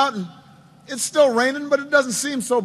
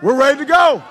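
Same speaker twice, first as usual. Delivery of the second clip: loud, emphatic group answer; rallying reply; 3 semitones higher, 7 dB louder than usual. Retold or imitated. Staff answers the candidate, loud rallying reply. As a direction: shout it back as the team. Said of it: "And they'd say".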